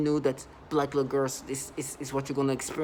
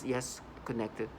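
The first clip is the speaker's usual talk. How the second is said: soft, low mock-analytical muttering; 3 semitones lower, 9 dB quieter, slower than usual. Mimicking perps who act like they're analyzing, in a mock-logical murmur. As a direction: lightly imitate someone piecing it together. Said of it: "analyzing this".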